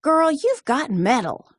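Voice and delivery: high, beaming praise; proud shout. A narrator's reading. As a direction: praise her with pride. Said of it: "Papa shouted proudly".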